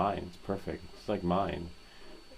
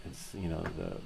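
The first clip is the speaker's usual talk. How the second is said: usual speaking voice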